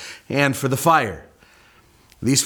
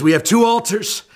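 showman's challenge; 7 semitones higher than usual. Retold or imitated. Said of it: "And so Elijah says".

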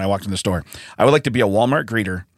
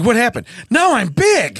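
put-on surprised man-child; 10 semitones higher, slower than usual. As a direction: squeak out the shock. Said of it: "You're like".

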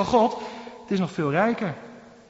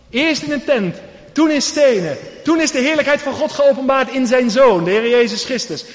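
louder, rising preacher's cry; preaching climax; 4 semitones higher, 5 dB louder than usual. Acted. Preacher crying out to the congregation, loud. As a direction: build it to a ringing peak.

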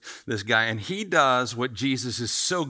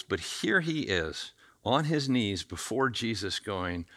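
ordinary delivery

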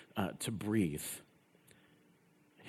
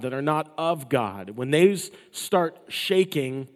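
louder, driving sermon delivery; forceful preaching; 4 semitones higher, 7 dB louder than usual. Acted. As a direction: preach it hard to the congregation.

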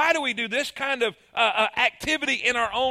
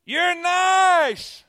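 high, loud call; the echo answering nice; echo shouting; 9 semitones higher, 6 dB louder than usual. Acted. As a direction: shout it warmly back. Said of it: "what he heard".

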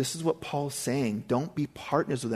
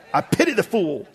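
Mister T; 7 semitones higher, 6 dB louder than usual. Retold or imitated. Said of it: "I love the Mister T".

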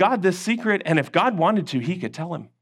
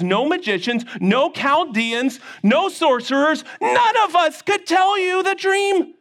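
rising protest; 8 semitones higher than usual. Acted. Servants protest to the king.

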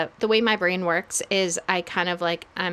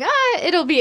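higher, breezy Australian-style wave-off; dismissive brush-off; 10 semitones higher, 5 dB louder than usual. Retold or imitated. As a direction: brush it away like it's nothing. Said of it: "almost a dismissive".

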